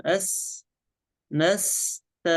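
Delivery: نست with a long, thin s before a light t; clear unvowelled sin. Shown as a demonstration. Sheikh demonstrates the sin's articulation, held clearly. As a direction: sustain the s in "نست". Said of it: "سين سكون".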